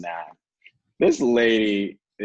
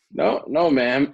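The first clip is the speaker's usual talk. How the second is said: high-pitched, louder quoted denial; higher, louder mock-polite reply; retold hasty denial; 7 semitones higher, 6 dB louder, slower than usual. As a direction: act out answering "ma'am" in a raised pitch. Acted answer to confronting stranger.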